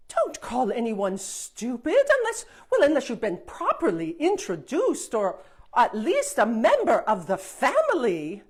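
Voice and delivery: loud, prim fairy scolding. Acted. Fairy scolding the princess for name-calling, loud and prim. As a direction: tell her off like a strict aunt.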